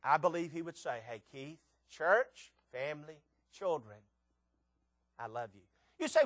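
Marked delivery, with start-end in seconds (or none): 1.1-5.6 low, hushed fatherly affection; tender fatherly voice. Acted. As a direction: speak softly and low, like a loving father. Voices the heavenly Father speaking lovingly.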